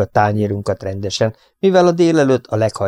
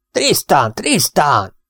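calling out Tristan, pitched much higher; shouting a name; 6 semitones higher, much slower than usual. A narrator's reading. Older brother yelling for his brother in the kitchen. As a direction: bellow down the hall to wake him. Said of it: "a konyhába ordítozik".

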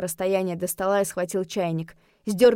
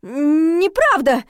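high, loud drawn-out squeak; high squealed protest; 8 semitones higher, 8 dB louder, much slower than usual. A narrator's reading. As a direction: squeak out a panicked denial. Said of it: "пискнула я".

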